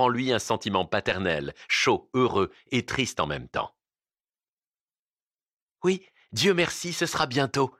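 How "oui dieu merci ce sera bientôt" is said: mild cry. A narrator's reading. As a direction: call out lightly in a raised pitch. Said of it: "cria Ninotchka".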